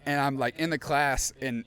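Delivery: normal talking voice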